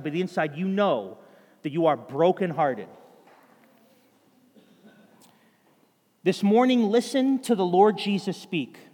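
building sermon fervour; impassioned preaching. Acted. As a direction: press it on the congregation with force. Preaching at full force.

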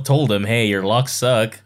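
normal talking voice

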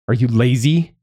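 angry accusation. Acted. Mother accusing her son of laziness, angry. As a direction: demand it with some anger.

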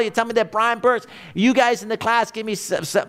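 ordinary delivery

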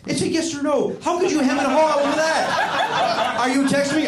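high, laughing, teasing; mock exasperation. Real, not acted. Teasing the producer over his hemming, high and laughing. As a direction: needle him playfully.

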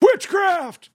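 high, loud shout of witchcraft; shouted accusation. Pretend. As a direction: yell it like a preacher accusing.

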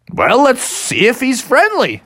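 high, swooping bear voice; game suggestion. Acted. Bears deciding to approach the triceratops, game.

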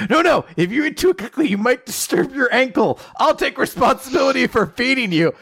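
hammed-up doting voice; mock earnest boyfriend. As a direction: fuss over her anxiously. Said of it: "he's like".

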